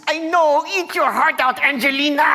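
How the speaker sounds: high, triumphant put-on crowing; gloating diva. All-bones diva taunting Angelina, gloating. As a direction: crow it up high, stretching the last word.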